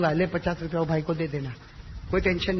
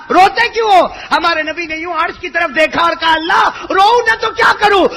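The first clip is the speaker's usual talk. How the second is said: high, loud fervent sermon cry; preaching at full force; 12 semitones higher, 10 dB louder than usual. Acted. Preacher voicing God consoling the weeping Prophet, fervent shout. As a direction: shout it with tearful passion.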